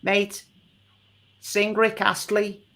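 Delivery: put-on a Liverpool heckler calling out, Scouse accent; Scouser accent. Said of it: "I had a Scouser saying".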